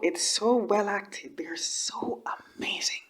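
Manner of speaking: unvoiced, slow, hushed praise; awed whisper praising the actresses. Real, not acted. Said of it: "whispered awe at acting".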